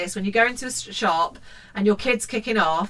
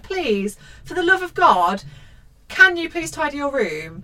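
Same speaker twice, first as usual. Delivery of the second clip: exasperated mum; 5 semitones higher than usual. Acted. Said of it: "She's like".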